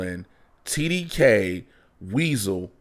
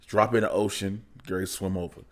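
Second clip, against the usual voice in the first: ordinary talk, nothing marked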